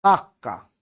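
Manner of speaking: কাকা said kak-ka, k held; doubled consonant. Shown as a demonstration. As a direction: stop on the middle k of কাকা, then release. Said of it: "কাকা হয়ে গেল কি?".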